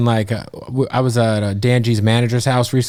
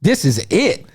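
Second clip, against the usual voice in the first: hyped; 10 semitones higher, slower than usual. Retold or imitated. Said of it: "But I was like".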